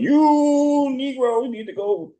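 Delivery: high, slow put-on Obama voice; drawn-out mock Obama lecture; Obama impression.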